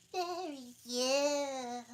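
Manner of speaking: high, very slow dormouse; drowsy creature drawl. A narrator's reading. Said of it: "said the Dormouse".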